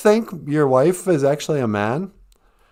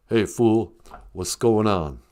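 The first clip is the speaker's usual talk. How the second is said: ordinary talk, nothing marked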